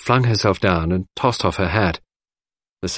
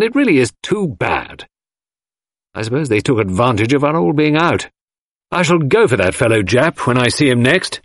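angry outburst; 5 semitones higher than usual. A narrator's reading. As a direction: let the anger boil over, pitched up. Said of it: "an unaccustomed frown of anger".

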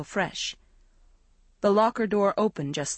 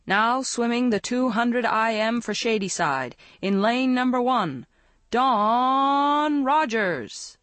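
announcer voice; 5 semitones higher, slower than usual. A narrator's reading. Swimmer mock-announcing herself to teammates, booming and drawn out. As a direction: announce her like a stadium PA. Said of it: "cupped her hand into a microphone".

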